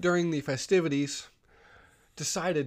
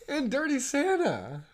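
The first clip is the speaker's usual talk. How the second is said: loud, high, drawn-out incredulity; laughing disbelief; 13 semitones higher, 12 dB louder, slower than usual. Real, not acted. Listener reacting to tree gift, laughing disbelief. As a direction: exclaim it slowly through laughter.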